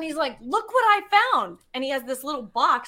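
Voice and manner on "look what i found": high, eager show-off call; excited. Retold or imitated. Fiance showing her a little box, excited. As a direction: call out a discovery in a raised voice.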